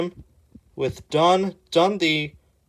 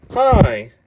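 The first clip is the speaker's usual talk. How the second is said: cheery greeting; 4 semitones higher, 8 dB louder than usual. Acted. Voicing Peeps greeting.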